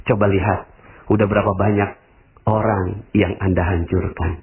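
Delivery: normal talking voice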